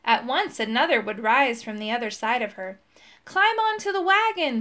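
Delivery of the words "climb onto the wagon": put-on higher, urgent shout to the old woman. A narrator's reading. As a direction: call her aboard over the noise. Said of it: "shouted Moongobble".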